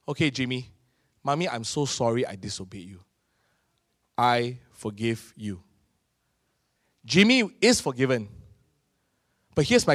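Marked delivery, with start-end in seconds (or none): 0.1-5.6 low, much softer and gentle, slowing on the last words; forgiving mother. Retold or imitated. Mother forgiving her son Jimmy, soft and gentle. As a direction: forgive him tenderly.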